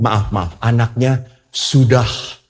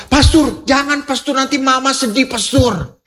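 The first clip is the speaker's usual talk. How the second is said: higher, eager, insistent protest; pleading young woman; 12 semitones higher than usual. Retold or imitated. Woman begging the pastor not to judge her fiance, pleading. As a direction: keep defending him, pleading high.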